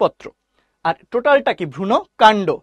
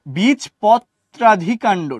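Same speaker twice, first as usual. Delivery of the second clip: বীজপত্রাধিকাণ্ড drawn out very slowly; very slow term; much slower than usual. Shown as a demonstration. Teacher to students, slow drilled term. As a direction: stretch বীজপত্রাধিকাণ্ড syllable by syllable. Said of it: "নামটা খুবই দুষ্টু".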